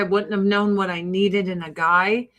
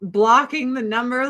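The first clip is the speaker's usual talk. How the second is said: ordinary talk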